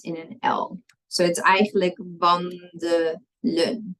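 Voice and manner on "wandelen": "wandelen" slowly as van, de, len; syllable by syllable. Shown as a demonstration. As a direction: sound out "wandelen" with a weak "duh" in the middle. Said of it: "it's wandelen".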